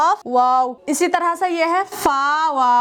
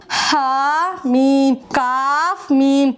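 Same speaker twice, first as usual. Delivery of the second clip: slow letter naming; 3 dB louder, much slower than usual. Shown as a demonstration. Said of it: "teacher reads letter names slowly".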